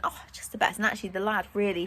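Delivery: ordinary talk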